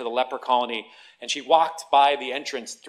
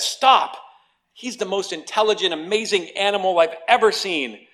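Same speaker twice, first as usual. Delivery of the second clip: butcher's shout; 6 semitones higher, 6 dB louder than usual. Acted. Butcher stops angry man.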